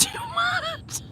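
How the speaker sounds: high laugh-talk; real laughter between words; laughing hard. Real, not acted. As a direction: voice squeaking through laughter.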